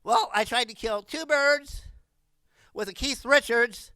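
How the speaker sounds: normal talking voice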